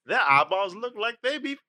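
a mild Southern twang; hint of a Southern accent. Shown as a demonstration. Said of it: "I was doing Southern".

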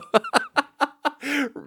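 very high, rapid giggle; high cackle. Real, not acted. Amazed at buddy's success with women, high giggle. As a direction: crack up at your buddy's charm. Laughs at friend's unexpected charm.

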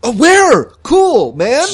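very high, loud hopeful burst; eager excitement. Acted. Andy hears Greg brought help, high and eager.

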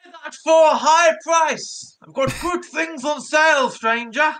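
sly shopkeeper calling out to a stranger; merchant voice. Acted. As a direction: hawk your wares like a shady merchant. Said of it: "our merchant voice now".

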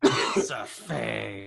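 very slow, drawn-out mock outburst; hammy quote of a meme; imitating meme line. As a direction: stretch FAKE out theatrically. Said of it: "what kind of emotion he's expressing".

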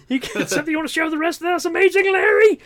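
high, rushed rant rising to a yell; mock outburst. Quoting Pee-wee confronting Amazing Larry, high mock yell. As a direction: build to a high shouted name. Acted film line, yelled at Larry.

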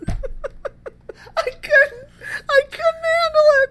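high squeal through real laughter; laughing so hard the words barely get out; helpless laughter. Real, not acted. Cohost recalling the dumbest scene, helplessly laughing. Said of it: "I laughed to the point of tears".

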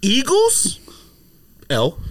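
ordinary talk, nothing marked

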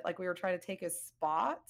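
ordinary delivery

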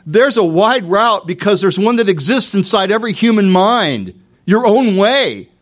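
loud, high emphatic sermon voice; preacher at full volume; full-force preaching. Acted. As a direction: drive the point home from the pulpit.

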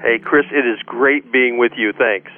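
ordinary delivery

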